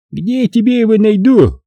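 high-pitched, mildly angry comeback; understated anger in a high character voice; angry retort. A narrator's reading. Vakha retorts to being asked about the killer, mildly angry. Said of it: "зло ответил он".

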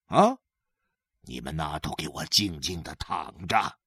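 low, soft, drained voice; father quietly ordering children to lie still, saving his strength; hunger-weakened father. Acted. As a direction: sound spent from hunger, mostly hushed. Father, weak from hunger, gives orders.

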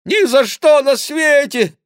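high-pitched loud refusal; monk's yell. A narrator's reading. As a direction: refuse at a slow, high shout. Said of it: "закричал Гранфло". (громкий высокий отказ монаха)

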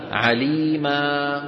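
slow chanted عليما ending in a long a; stop with alif. Shown as a demonstration. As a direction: hold the final a of عليما. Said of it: "كيف نقف على عليما".

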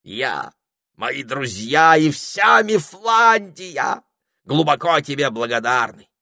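high, slow, loud parrot speech. A narrator's reading. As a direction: declare gratitude grandly. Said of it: "сказал попугай".

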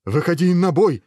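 high, loud battle call; shouted challenge. A narrator's reading. Hero challenging the chess-playing heads, high shout. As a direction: holler the challenge. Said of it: "крикнул герой".

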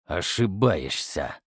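slow low predatory snarl; bare your teeth as you say it. A narrator's reading. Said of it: "оскалился я".

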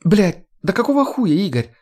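high, agitated female character voice; exasperated young woman jabbering; agitated chattering. A narrator's reading. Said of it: "затараторила девушка".

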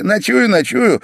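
very high, lively double assent; eager assurance. A narrator's reading. Pavel promising to stay the night, eager.

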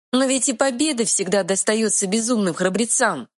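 high little fairy voice; bright tiny fairy, defiant; pixie voice. Acted. Fairy speaks after punching troll.

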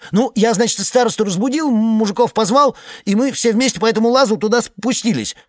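high, loud, agitated telling; excited high report. Acted. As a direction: blurt it out, worked up. Iceman recounts while hurrying.

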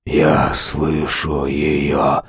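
low, slow dying rasp; wheezing. A narrator's reading. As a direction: wheeze it out, near death. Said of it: "прохрипел".

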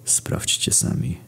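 eerie whispered story ending; creepy whisper. A narrator's reading. (upiorny szept)

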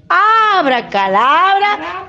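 high, slow incantation; magic spell. Acted. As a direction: chant the spell like a fairy godmother. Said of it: "empieza a decir".

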